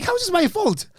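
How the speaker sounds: high-pitched mock indignation; incredulous protest. Comedian blamed for the injured woman, incredulous protest. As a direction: push the question up high in disbelief.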